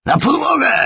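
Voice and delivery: very high, loud roar; roared order. Acted. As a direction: yell the order at the top of the voice. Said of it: "ryknął". (ryk, bardzo wysoko i głośno)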